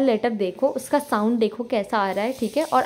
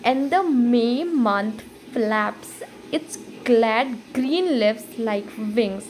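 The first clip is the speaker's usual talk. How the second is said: very slow, weighing every syllable; careful slow reading; much slower than usual. Shown as a demonstration. Teacher showing students how to read a poem line, very slow. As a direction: read it the way she wants students to in the exam. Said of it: "Literally आपको ऐसे पढ़ना हो पड़ेगा कि".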